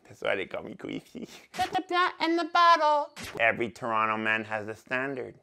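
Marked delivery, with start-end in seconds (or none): 1.5-3.1 high voice. Acted. Sudden high voice line.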